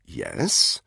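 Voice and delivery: a short yes with an edge; captain with an edge in his voice; edged. A narrator's reading. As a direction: say it with a definite edge. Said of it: "a definite edge in his voice".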